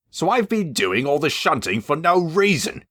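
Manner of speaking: cross, high complaint; growling. Acted. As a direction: grumble it out crossly. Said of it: "he growled".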